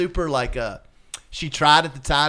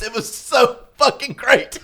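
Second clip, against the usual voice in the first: usual speaking voice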